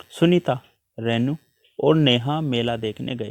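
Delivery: short pauses after Sunita and Renu; comma pauses in list. Shown as a demonstration. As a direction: set Sunita and Renu apart with small breaks. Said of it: "Sunita और रेनू के बीच में हम थोड़ा रुके".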